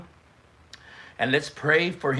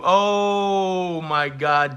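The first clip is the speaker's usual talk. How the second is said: very slow, drawn-out and higher; stunned; 5 semitones higher, 5 dB louder, much slower than usual. Real, not acted. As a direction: let the shock stretch out. Reacting to the roast.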